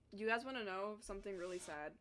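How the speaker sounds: ordinary delivery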